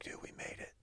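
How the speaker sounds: hushed excited whisper. Retold or imitated. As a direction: whisper it like a secret triumph. Retold thrilled aside to himself.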